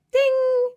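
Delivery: high, loud sung "ding" like a bell; ping it out like a chime; playful chime sound. Pretend. Imitating a bell for each extraction.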